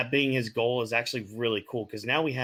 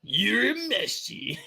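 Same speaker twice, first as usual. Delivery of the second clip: high, slow cartoon tough-guy voice; Kuwabara impression; 11 semitones higher, slower than usual. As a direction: drag it out high like an anime brawler. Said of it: "That was a spot-on impression".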